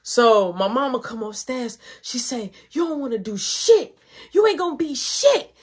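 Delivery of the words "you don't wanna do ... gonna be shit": retold berating. Retold mother berating her.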